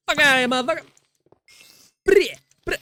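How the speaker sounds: high, sharp yell at the screen; high-pitched outburst at the game; shouted at game. Real, not acted. Yelling at game.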